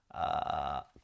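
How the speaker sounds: low creaky edge voice; vocal fry. Shown as a demonstration. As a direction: rasp from the false vocal folds. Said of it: "この音ですね".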